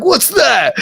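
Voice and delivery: high, mocking what's that; amused disbelief. Dime laughing at the teen's sweaty joint, amused disbelief. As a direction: scoff at the puny joint.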